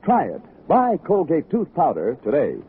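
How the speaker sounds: normal talking voice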